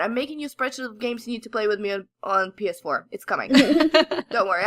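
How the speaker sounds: normal talking voice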